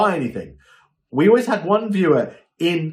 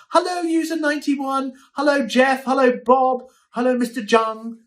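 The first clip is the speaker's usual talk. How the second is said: much higher, sing-song roll call of hellos; mock repetitive greeter; 11 semitones higher than usual. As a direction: parody a host greeting everyone one by one. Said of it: "they're like".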